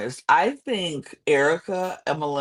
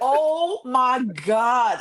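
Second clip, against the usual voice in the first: high, drawn-out exasperated cry; mock scream; 9 semitones higher, much slower than usual. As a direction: stretch it out slowly in high disbelief. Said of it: "I screamed that eight times".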